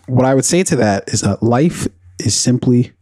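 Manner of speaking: ordinary talk, nothing marked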